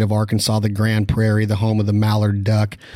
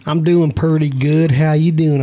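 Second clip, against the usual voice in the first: high, louder mock Southern accent; Southern drawl; 6 semitones higher, 4 dB louder than usual. Host teasing his Arkansas guest in a mock Southern accent. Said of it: "so I'ma talk like this".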